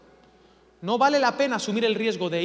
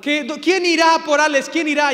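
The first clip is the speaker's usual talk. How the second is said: loud, higher, hammed-up heavenly plea; mock dramatic father; 6 semitones higher, 9 dB louder than usual. God the Father asking who will go, hammed-up loud plea. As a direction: call out to heaven for a volunteer. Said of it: "Nos han dicho".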